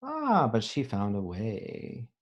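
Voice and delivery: ordinary talk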